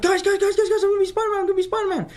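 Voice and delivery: running downstairs shouting to his family, thrilled; excited yelling. Retold or imitated. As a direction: burst downstairs yelling the big news. I was screaming and shouting.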